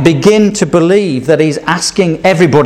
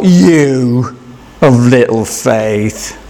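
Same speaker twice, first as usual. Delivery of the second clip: "You", a long pause, then "of little faith" lower and slow; paused rebuke; 4 semitones lower, much slower than usual. Shown as a demonstration. Jesus to Peter, drawn out with a pause. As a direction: hold a beat after "You". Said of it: "hard to know how Jesus said".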